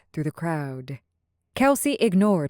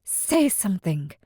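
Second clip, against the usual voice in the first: usual speaking voice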